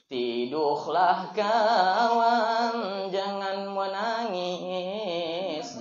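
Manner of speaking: very slow, drawn-out lullaby tone; lulling. Pretend. Preacher mock-lulls his friend lying on his lap, very slowly. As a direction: drawl it out like rocking someone to sleep.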